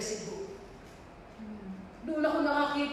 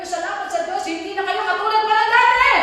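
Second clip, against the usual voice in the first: loud, higher, chanted proclamation; full-force preaching; 5 semitones higher, 10 dB louder than usual. Acted. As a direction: build to a sung shout of praise.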